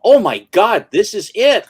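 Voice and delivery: excited realization, half-shouted; stunned recognition. Retold or imitated. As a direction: voice jumps up and gets loud as it clicks. Sudden recognition of the movie.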